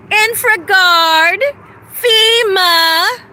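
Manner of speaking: crying out the names, voice cracking high; real crying as the list goes on; voice breaking. Real, not acted. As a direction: near tears, words breaking up. Breaking down while listing agencies.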